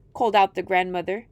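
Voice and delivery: usual speaking voice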